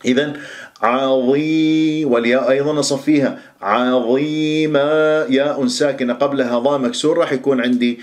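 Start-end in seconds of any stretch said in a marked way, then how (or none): 3.6-4.8 عظيمة slow with emphatic ظاء; heavy ظاء. Shown as a demonstration. Teacher correcting the common عظيمة mistake. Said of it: "فالبعض يقرأها عظيمة".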